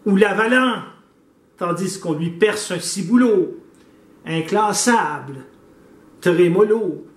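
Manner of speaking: measured declamation in separated phrases; poem recitation. Acted. Reciting a prayer poem to Saint Antoine, declaimed. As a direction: recite it like a mock-solemn prayer, pausing after each phrase.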